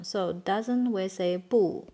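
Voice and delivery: normal talking voice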